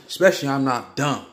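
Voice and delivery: ordinary delivery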